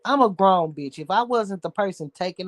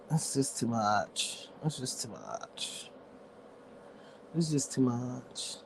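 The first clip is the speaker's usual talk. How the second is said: weary sigh; 5 semitones lower, 13 dB quieter, slower than usual. Real, not acted. Exhausted, repeating herself.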